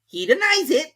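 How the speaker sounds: high, loud pronouncement; high, loud king; king ruling. A narrator's reading. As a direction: announce it to the court. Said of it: "said the king".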